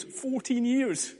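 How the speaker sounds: Fourteen years drawn out high and slow; slow emphatic repeat. Acted. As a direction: let Fourteen years land in slow disbelief.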